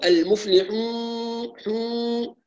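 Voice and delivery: المفلحون slowed, with a nasal twang on the و; nasal waw. Shown as a demonstration. Teacher showing students the nasalised waw mistake. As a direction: let حون ring through the nose. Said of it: "نسمع كثيرًا خيشمة مع الواو".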